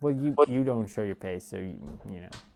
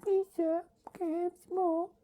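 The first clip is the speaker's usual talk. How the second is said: sad Oliver plea; 4 dB quieter than usual. Shown as a demonstration. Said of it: "This is me sad".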